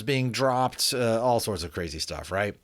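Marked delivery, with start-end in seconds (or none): none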